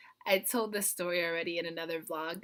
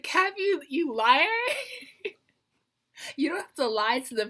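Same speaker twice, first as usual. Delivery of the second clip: mock accusation; 8 semitones higher, 6 dB louder than usual. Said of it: "I was like".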